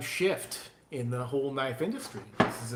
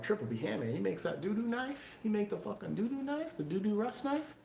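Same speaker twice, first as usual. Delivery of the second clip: higher, softer scoffing customer voice; mocking customers; 6 semitones higher, 5 dB quieter than usual. Mocking picky buyers dissing his knives, in a higher, softer scoff. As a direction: sneer like a scornful buyer. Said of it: "people are like".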